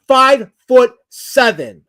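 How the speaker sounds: loud, high and drawn out word by word; emphatic indignant repeat. Real, not acted. Streamer insisting on his height to a heckler, emphatic. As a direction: hammer out FIVE-FOOT-SEVEN slowly and loudly.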